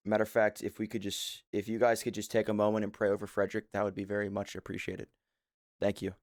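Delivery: low, hushed, grave announcement; mock solemn. Streamer asks chat to pray for dying Frederick, mock solemn. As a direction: break grim news gravely and ask for prayers.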